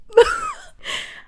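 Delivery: very high squealed laugh. Real, not acted. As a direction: lose it in a squeal. Cracks up at the scene.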